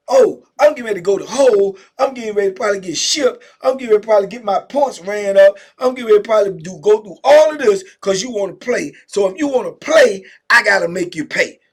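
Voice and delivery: loud, heated threat; furious threat. Retold or imitated. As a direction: vow to make him pay. Angry celly's threatening inner voice.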